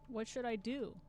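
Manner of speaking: ordinary talk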